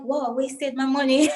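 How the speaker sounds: rueful self-mocking laugh. Guest retelling to host how she felt she wasted money, rueful laugh. Retold disappointment then laugh.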